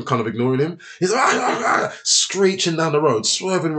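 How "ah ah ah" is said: high-pitched wordless yammering; mock a man yelling nonsense into his phone; imitated phone shouting. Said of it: "he's just shouting on the phone".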